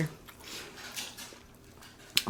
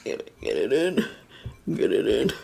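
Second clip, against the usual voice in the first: low coaxing voice; 9 semitones lower than usual. Pretend. Coaxing food into mouth.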